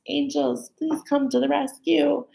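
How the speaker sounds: half-joking cry for rescue; playful plea. Acted. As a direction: call on the angels in playful distress. Playful mock plea to angels.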